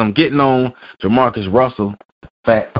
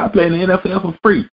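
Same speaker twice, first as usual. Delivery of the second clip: mock quote; 9 semitones higher, faster than usual. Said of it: "Jamarcus Russell talking about".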